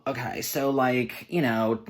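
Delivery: ordinary delivery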